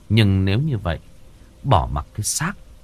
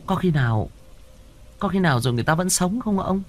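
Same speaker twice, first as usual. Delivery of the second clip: high, alarmed woman's voice; a frightened wife asking fast; startled wife voice; 9 semitones higher, faster than usual. Acted.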